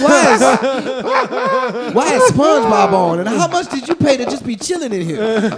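high, laughing panic; baffled at the naked man on the bed; freaked-out laughing. Retold or imitated. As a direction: sputter it out, thrown off.

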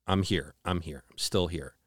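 soft, gentle reassurance; softly reassuring. Retold or imitated. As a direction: quietly comfort someone beside you. Reassuring partner in the dark dream.

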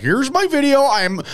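high-pitched mocking impression, slowing down at the end; imitating Schefter. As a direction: lampoon a reporter bragging about his scoop. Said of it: "He was just like".

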